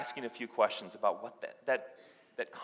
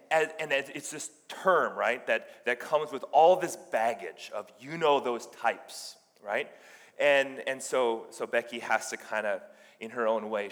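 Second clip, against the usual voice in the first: normal talking voice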